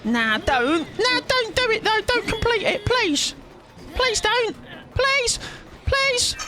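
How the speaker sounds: squeaky high begging voice; high mock pleading. Killer begging a survivor not to finish the generator, squeaky high pleading. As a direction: beg in a tiny high voice.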